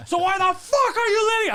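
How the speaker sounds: very high, loud outburst; acted-out furious question; retold going crazy. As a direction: demand an explanation at a shout. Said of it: "I'm going crazy".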